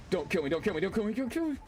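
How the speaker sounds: rapid repeated plea climbing high; panicked pleading. Real, not acted. Player begging game enemies to spare him, panicky. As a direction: beg in a rising game panic.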